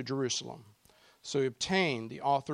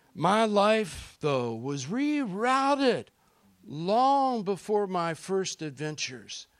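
slow emphatic; 6 semitones higher, 4 dB louder, slower than usual. Acted. Dramatic sermon emphasis.

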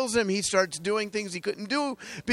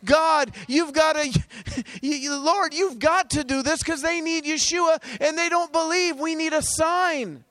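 crying out to God; 7 semitones higher than usual. Retold or imitated. Preacher retelling how he cried out to God, urgent pleading. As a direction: beg God with rising passion, slowing at the end. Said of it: "now I'm crying out to God".

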